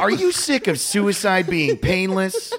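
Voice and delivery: high eager pitchman voice; infomercial. Acted. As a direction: call out to viewers like an ad. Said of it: "It's like an infomercial".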